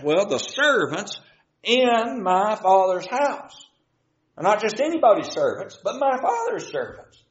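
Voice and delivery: preacher lightly acting the prodigal son's longing; voicing the prodigal son. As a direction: plead like a hungry son remembering home. Prodigal son reasons aloud.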